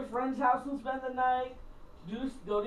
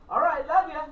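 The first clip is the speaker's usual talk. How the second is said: breezy loud goodbye; 4 semitones higher, 7 dB louder than usual. Retold or imitated. Cheerfully hanging up on caller.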